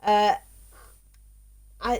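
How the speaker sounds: ordinary talk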